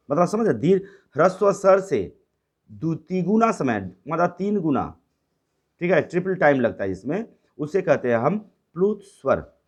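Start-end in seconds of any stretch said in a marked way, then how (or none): none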